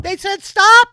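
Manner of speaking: high, loud excited yell; Lux shouting. Acted. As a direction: yell it down at the rider. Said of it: "you hear Lux like".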